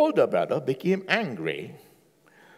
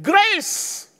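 grace proclaimed; 13 semitones higher, 3 dB louder than usual. Real, not acted. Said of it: "What is this everything?".

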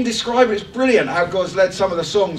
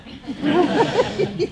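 laugh at own voice; 9 semitones higher than usual. Real, not acted. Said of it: "Oh, my voice is getting higher".

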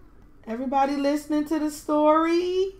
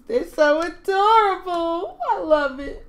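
gushing; 6 semitones higher, 5 dB louder than usual. Real, not acted. Gushing over her sim babies.

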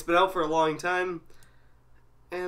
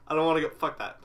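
usual speaking voice

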